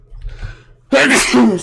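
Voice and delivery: loud sudden sneeze; a sharp real sneeze; sneezing. Real, not acted. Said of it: "I'm sneezing a lot".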